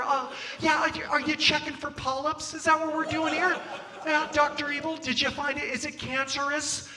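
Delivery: high, stammering, over-polite dodging; Jason Bateman. Retold or imitated. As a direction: imitate a nervously polite man avoiding confrontation. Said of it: "I end up sounding like Jason Bateman from Ozark".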